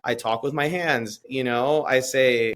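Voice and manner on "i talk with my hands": ordinary talk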